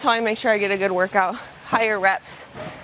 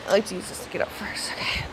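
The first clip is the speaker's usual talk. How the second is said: unvoiced whisper to the camera; soft gym whisper; 3 semitones lower, 8 dB quieter than usual. Real, not acted. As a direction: whisper it close to the mic.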